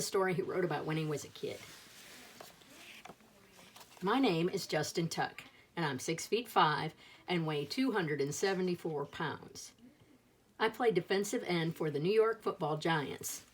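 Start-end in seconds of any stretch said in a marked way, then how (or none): none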